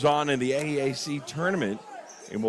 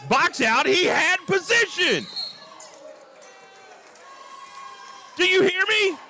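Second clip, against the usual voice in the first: outraged commentator demanding a call; emotional outburst. Real, not acted. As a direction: shout in real indignation, pitch pinned high. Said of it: "I get a little emotional".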